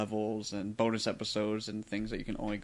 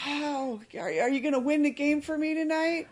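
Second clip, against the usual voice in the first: put-on excited child fan. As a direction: squeal it like a starstruck kid.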